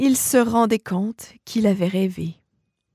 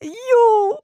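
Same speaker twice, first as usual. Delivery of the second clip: sobbing cry; 13 semitones higher, 4 dB louder than usual. Acted. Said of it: "balbutia-t-il entre deux sanglots".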